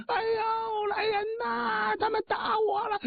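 put-on old woman screams for help while being beaten; crying for help. Old woman thrown down calls out, wailing high. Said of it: "老太太当然得喊呐".

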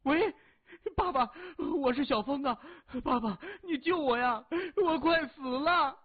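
high, loud cries for rescue; panicked screaming. A narrator's reading. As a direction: scream desperately down the phone to father. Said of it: "李峰大叫着". (惊恐地高声呼救)

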